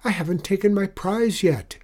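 high-pitched, loud complaint; protesting boy. A narrator's reading. Alfie wants his victory prize, high protest. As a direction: whine that you're not finished playing.

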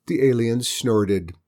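ordinary delivery

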